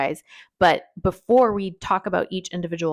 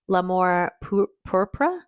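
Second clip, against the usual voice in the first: slow, halting attempt at French; attempted French accent; slower than usual. Shown as a demonstration. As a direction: sound out the French name slowly. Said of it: "laughing at my French".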